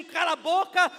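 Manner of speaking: loud, insistent retold rebuke; escalated acted shout; crowd shouting down. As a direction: shout him down, imitating the crowd. Crowd silencing him more forcefully.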